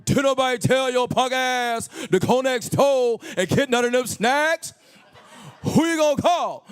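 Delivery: loud, high, drawn-out scolding impression; Bernie Mac. Dead comedian returns to scold the high man, loud impression. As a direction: holler a comedian's scolding, stretching the words. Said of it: "Bernie Mac actually came back from the dead".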